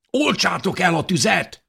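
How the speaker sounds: high, urgent cry; shouting. A narrator's reading. Man calls through thick smoke to the others, shouting. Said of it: "kiáltotta Káleb bátyja".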